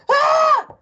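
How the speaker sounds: loud, very high shriek; scream. A narrator's reading. Wife waking with a frog on her, screaming. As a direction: let out a piercing high shriek. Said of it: "She screamed again".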